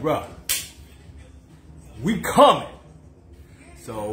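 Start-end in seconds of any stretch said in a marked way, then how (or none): 0.0-2.7 excited shout. Retold or imitated. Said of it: "I was like".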